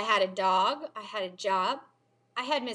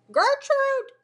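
high, loud parrot squawk; screaming parrot; 6 dB louder than usual. A narrator's reading. Parrot calling out in the pet store, screamed. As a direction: screech it out like a parrot. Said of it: "the parrot screamed again".

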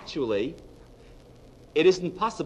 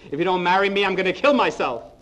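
desperate threat; 5 semitones higher, 5 dB louder, faster than usual. Acted. Pregnant woman threatening her lover.

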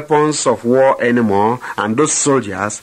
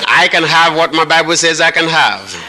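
preaching at full force; 5 semitones higher than usual. Acted. Preacher leading the congregation against the devil, full-force proclamation. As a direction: proclaim it like a battle cry to the devil.

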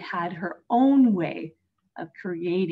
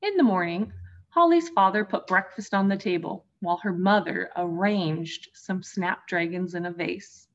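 usual speaking voice

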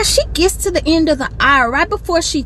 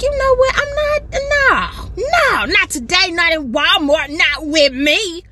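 higher, rising to a shout then settling firm; fed up; 4 semitones higher, slower than usual. Retold or imitated. Deciding to confront the rude lady in Walmart, fired up. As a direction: build up to a shout then plant your feet. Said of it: "I was like".

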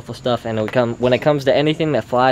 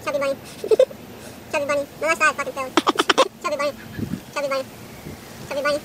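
high mimicking; 13 semitones higher, 6 dB quieter, much faster than usual. Mocking his friend's stuffed-mouth attempts.

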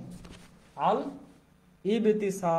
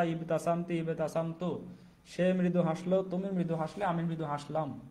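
ordinary talk, nothing marked

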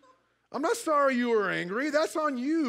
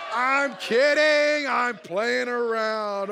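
loud, drawn-out "just joking"; emphatic joking retraction; 5 dB louder, much slower than usual. Real, not acted. Preacher takes back a joke to the congregation, loud and drawn out. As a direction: stretch it out loud, easing off at the end.

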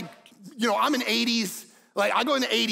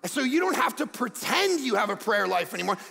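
normal talking voice

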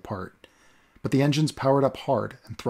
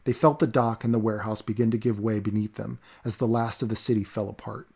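ordinary delivery, nothing marked

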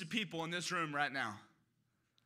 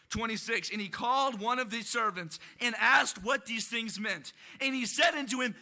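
usual speaking voice

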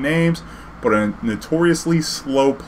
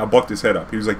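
ordinary talk, nothing marked

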